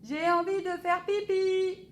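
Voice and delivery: high loud urgent plea; boy calling papa about needing the toilet; child shouting. A narrator's reading. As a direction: shout it like it can't wait. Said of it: "crie Alphonse".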